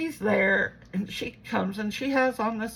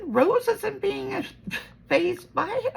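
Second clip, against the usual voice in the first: insistent; 11 semitones higher than usual. Retold or imitated. Retells arguing with her mom.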